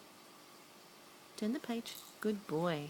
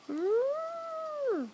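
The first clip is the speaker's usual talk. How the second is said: high, long mock trumpeting; elephant trumpet; 12 semitones higher than usual. Making noise for elephant.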